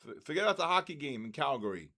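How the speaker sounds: usual speaking voice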